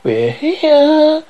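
high, sing-out eerie voice; high-pitched voice. Acted. Spirit announcing its arrival, high-pitched voice. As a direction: pitch it high and hold the last word. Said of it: "High-pitched voice".

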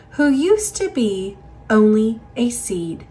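usual speaking voice

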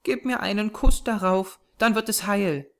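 put-on high child's voice, serious; earnest little girl. A narrator's reading. Small girl to her companion about her sore spot, earnest. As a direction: instruct him earnestly in a small girl's voice. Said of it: "versetzte sie ernsthaft".

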